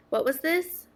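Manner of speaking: normal talking voice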